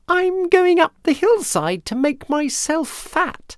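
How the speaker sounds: very high, squeaky little goat; tiny goat voice. A narrator's reading. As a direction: pipe up in a tiny high-pitched voice. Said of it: "in his tiny voice".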